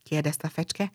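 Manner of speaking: plain reading voice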